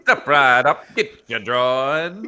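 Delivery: loud, high hawker's pitch, laughing, slowing at the end; carnival barker. Retold or imitated. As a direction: sell droids to a passing crowd. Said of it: "He's a fucking carnival barker".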